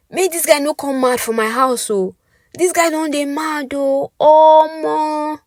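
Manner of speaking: exasperated Nigerian Pidgin neighbor; high, loud Nigerian Pidgin complaint. Acted.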